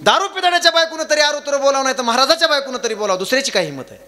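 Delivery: high, fast comic declamation; rapid preaching. Real, not acted. Kirtankar teases about wives who talk back, rapid high declamation. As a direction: rattle it out high to the crowd.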